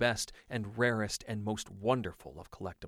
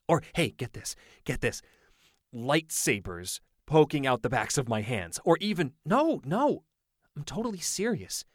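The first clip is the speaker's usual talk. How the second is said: lightly voiced excited boy, tumbling out ideas; a young friend bursting with schemes; hint of a hyped-up kid; eager kid Owen; 5 semitones higher, 4 dB louder than usual.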